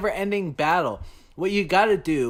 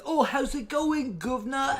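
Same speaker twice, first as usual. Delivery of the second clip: mock Cockney accent; 10 semitones higher than usual. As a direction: say it like a Cockney caricature. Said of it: "she was like".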